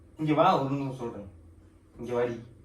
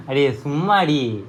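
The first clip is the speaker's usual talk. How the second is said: very slow, stretched and louder; drawn-out coaxing; 5 dB louder, much slower than usual. Real, not acted. Husband coaxing his upset wife, slow drawn-out teasing. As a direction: stretch it out to coax her.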